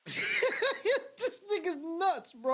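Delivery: high, slow, laughing so hard it cracks; crying laughing. Real, not acted. Watching the rant, wheezing with laughter. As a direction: squeeze the words out through laughter.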